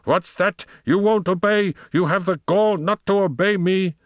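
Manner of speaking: very high, indignant outburst; dreadful hissing fury. A narrator's reading. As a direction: rage at the dog in a high, strained voice. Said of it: "in a dreadful hissing voice".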